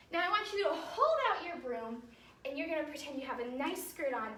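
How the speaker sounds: ordinary talk, nothing marked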